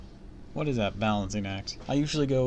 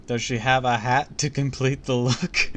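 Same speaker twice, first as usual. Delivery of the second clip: laughing; 4 semitones higher, 6 dB louder than usual. Real, not acted. Reader laughs at description.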